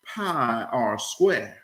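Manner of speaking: slow, measured, deliberate delivery; slow recitation. Acted. Grandson recites algebra to grandfather, slow and deliberate. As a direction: space out each word of the formula.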